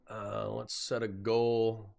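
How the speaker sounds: normal talking voice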